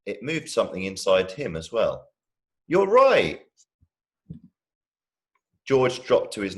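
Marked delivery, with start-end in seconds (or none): 2.7-3.5 humouring enthusiasm. Acted. Big brother humouring.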